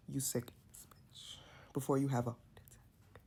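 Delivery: hissed taunt in a breathy whisper; K Rican whisper. Retold or imitated. K Rican taunting Dolly, imitated in a whisper. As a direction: whisper the insult like a sly roommate. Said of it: "That was a nice K Rican".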